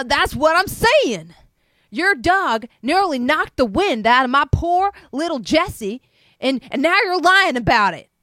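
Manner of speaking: loud, high furious threat; yelling. Acted. Neighbor yelling a threat to call animal control, loud. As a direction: done with it, shout him down. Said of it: "Frank yells".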